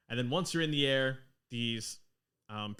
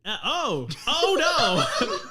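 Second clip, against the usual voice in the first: much higher, louder alarmed "oh no" with a laugh; shocked dwarf; 13 semitones higher, 8 dB louder, much slower than usual. Acted. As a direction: gasp in mock alarm. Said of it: "her accent has always been the same".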